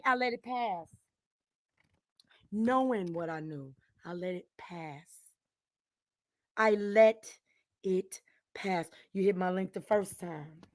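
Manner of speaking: low, soft, halting aftermath of rage; quiet, controlled and low; hushed restraint. Real, not acted. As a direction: drop right down, pausing between words. Sudden hush after furious shouting.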